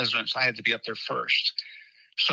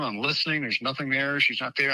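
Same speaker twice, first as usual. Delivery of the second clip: ordinary talk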